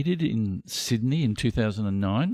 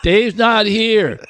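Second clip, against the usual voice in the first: slow, louder, higher stoner voice; 9 semitones higher, 7 dB louder, much slower than usual. Retold or imitated. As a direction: drag it out, stoned and loud. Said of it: "The stoner voice is an easy one to do".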